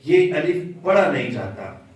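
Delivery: ordinary talk, nothing marked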